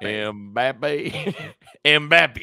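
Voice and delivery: drawled country-accent Mbappe. Shown as a demonstration. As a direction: say it slow like a country boy, amused. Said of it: "say it with a country accent".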